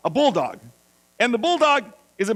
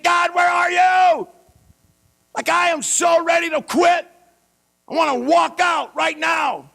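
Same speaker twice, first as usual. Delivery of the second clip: desperate shouted prayer; 7 semitones higher, slower than usual. Acted. Preacher voices desperate prayer.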